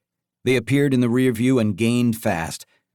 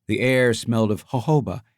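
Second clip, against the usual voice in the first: ordinary delivery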